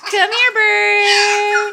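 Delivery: slow, drawn-out loud call. Real, not acted. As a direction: stretch the call to the bird. Calling bird to come over.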